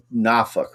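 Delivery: Norfolk said the local way, as "NAH-fuk"; local pronunciation. Retold or imitated. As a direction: drop the R and swallow the end of Norfolk: "NAH-fuk". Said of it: "I kept saying it wrong".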